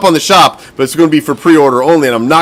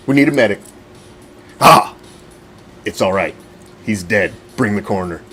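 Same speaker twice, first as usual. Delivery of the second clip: mock grim medic voice; 7 semitones lower, 5 dB quieter than usual.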